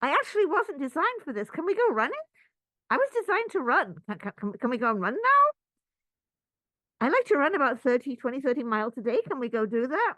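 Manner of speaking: high, loud, fast eager pleading; a restless kid begging to go running; eager body. Pretend. Body at the desk begging to run, high fast pleading. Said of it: "the body says".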